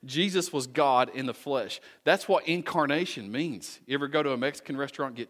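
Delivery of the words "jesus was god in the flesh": ordinary delivery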